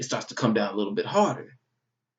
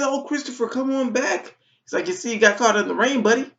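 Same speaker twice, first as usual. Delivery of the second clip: high, friendly boss voice; chipper manager; 11 semitones higher than usual. Retold or imitated. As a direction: greet the soaked applicant cheerfully. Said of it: "He said".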